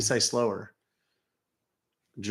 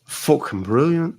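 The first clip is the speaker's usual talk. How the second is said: put-on Scottish accent; 4 semitones higher, 4 dB louder, slower than usual.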